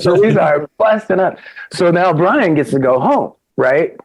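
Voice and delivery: ordinary talk, nothing marked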